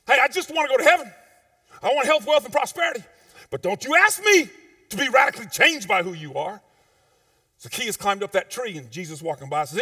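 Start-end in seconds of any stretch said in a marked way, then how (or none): 0.1-6.6 loud, fast, whiny demand for blessings; mock shallow churchgoer. Preacher mocking churchgoers who want only blessings. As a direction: mimic a greedy churchgoer, loud.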